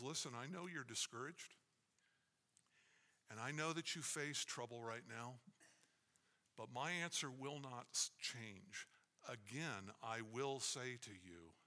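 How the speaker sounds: a hint of tender apostle; gentle reassurance. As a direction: reassure them quietly and steadily. Paul answers the discouraged.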